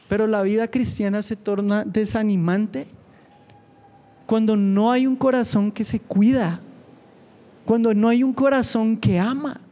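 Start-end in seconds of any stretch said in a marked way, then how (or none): 4.2-9.6 building preaching. Acted.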